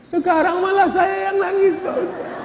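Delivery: very high whiny complaint; high mock-tearful confession to the preacher; mock crying man. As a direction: whine it like a weeping husband.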